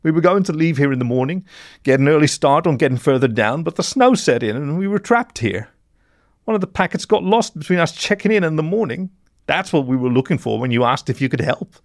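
high, distressed character voice; frightened mole in blue. A narrator's reading. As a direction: explain yourself as an upset, cornered accomplice.

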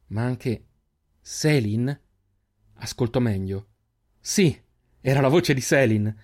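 ordinary delivery, nothing marked

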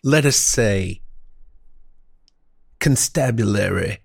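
halting, grandiose mock-genteel speaker; florid old-time orator. Host proffering a bold epigram, as a florid orator. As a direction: savor every pompous word with long pauses.

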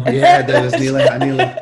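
loud laugh through the words; laughing hard while owning up to a naughty urge. Real, not acted. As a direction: burst out laughing mid-confession.

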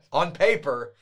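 slow, loud, laughing aside; laughing quip. Real, not acted. Co-host mocking the official story, laughing loudly. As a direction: laugh as you undercut the official line.

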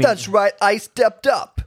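lightly done Italian New York accent; a touch of New York Italian swagger. Shown as a demonstration. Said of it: "that was my Italian, Italian New York accent".

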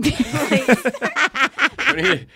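very high and very slow; drawn-out mockery. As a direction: stretch it out to mock it. Mocking the witch's claim.

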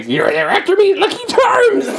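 the concussed guy's only line, hammed up; squealed mascot catchphrase; Lucky Charms leprechaun. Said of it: "He got hit on the head, so this is all he can say".

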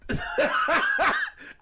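high, airy burst of laughter; breathless laugh. Real, not acted. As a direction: wheeze with laughter. Laughing at the status.

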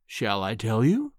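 low, coaxing question with a rise; cooing. A narrator's reading. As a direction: dangle the answer sweetly before him. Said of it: "he cooed".

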